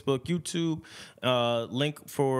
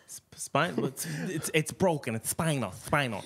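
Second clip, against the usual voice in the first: Mike Tyson impression; 3 semitones higher than usual. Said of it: "[name]'s Mike Tyson voice".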